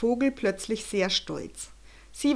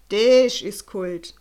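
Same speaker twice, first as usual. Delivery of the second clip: much slower, louder drawn-out wonder; balloonist marvels; 10 dB louder, much slower than usual. A narrator's reading. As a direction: savour the word slowly in awe. Balloonist exclaims.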